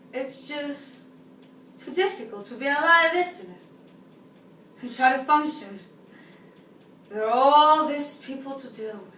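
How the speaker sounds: theatrical confiding with long pauses, swelling on key words; exasperated woman's monologue. Acted. Woman telling us about the supermarket, exasperated. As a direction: let the frustration build in swings from hushed to emphatic.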